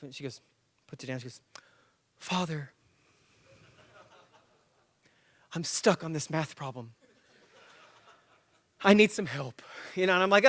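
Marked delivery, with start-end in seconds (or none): 2.2-9.6 lightly voiced daughter, overly proper; mock-polite drama queen. Daughter playing drama queen to her father, mock polite. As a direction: ask for help with mock politeness. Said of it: "she's a little drama queen".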